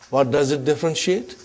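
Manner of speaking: usual speaking voice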